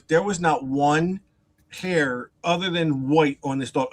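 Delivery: normal talking voice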